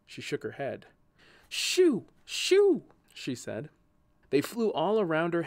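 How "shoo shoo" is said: high, loud sharp cries; two high, punched shouts; shooing away. A narrator's reading. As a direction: shoo off a swarm in alarm. Shooing away bees buzzing at her.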